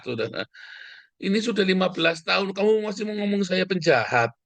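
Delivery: high, indignant self-defence; worked up and pushing back hard. Real, not acted. Defends himself against slander.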